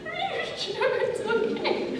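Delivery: high, slow, wobbling through a laugh; half-laughing mimic of a crying kid; teary small voice. Crying child asking her screen mom if it's okay. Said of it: "Like, sobbing".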